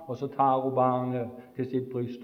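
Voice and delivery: ordinary delivery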